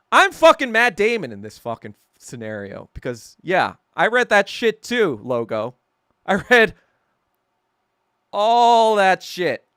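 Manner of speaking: loud, emphatic, indignant rant; heated boast. Real, not acted. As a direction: hit it hard, stretching the words out. Heated boast aimed at his rival.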